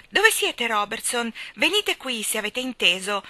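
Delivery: high, loud excited summons; excited call. A narrator's reading. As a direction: call out urgently for the servant, easing off at the end. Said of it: "chiamò emozionata".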